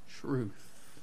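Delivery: breathy hushed "truth" after a pause. Real, not acted. Preacher hushing the congregation on the key word, barely voiced. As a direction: drop to a near whisper on "truth".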